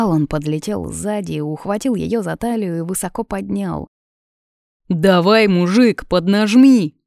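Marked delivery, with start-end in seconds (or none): 4.9-7.0 loud cheer from the crowd; raised, rousing heckle from an onlooker; shouted. A narrator's reading. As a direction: egg him on with a shout. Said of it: "крикнул кто-то из толпы".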